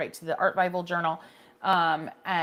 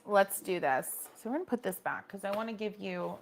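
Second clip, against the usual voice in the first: normal talking voice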